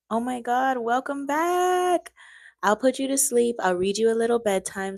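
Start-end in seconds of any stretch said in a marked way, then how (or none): 0.1-2.1 excited. Real, not acted. Greeting chat viewer.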